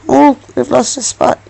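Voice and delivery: ordinary delivery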